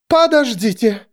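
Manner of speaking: high, urgent plea; alarmed plea. A narrator's reading. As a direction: beg them to hold off. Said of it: "ахнула епископ".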